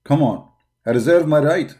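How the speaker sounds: ordinary delivery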